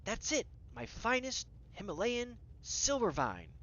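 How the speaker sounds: put-on thrilled regal cat with a British accent; delighted cat. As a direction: savor each word in British-accented delight. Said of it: "the cat's eyes light up".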